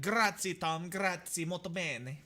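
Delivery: playful Italian flourish; Italian accent. Acted. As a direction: show off with a lively mock Italian accent. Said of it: "as the Italians would say".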